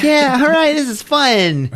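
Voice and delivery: put-on very high, gleeful girl voice; carefree girl impression. Girl skateboarding downtown, mock carefree glee. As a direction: pipe it up high in mock delight. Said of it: "She's like".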